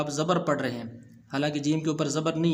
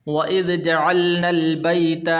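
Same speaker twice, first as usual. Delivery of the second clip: Quran recitation; 3 semitones higher, 7 dB louder than usual. Shown as a demonstration. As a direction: recite it in full tajweed. (قرآن کی تلاوت)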